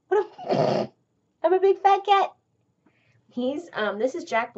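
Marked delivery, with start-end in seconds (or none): 0.1-2.3 high, louder silly cat voice with a laugh; voicing the cat. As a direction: speak for the cat, goofy and high.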